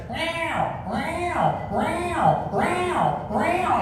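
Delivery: high, drawn-out mewing meows, one after another; imitating a cat's meow. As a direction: mimic a pestering cat that won't stop. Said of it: "and then the cat goes".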